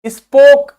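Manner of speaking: स्पोक loud and high with a full, long ओ; emphatic स्पोक with a rounded long o. Shown as a demonstration. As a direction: sound out the whole "o" in स्पोक, no e at the end. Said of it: "हमने पूरा ओ pronounce किया".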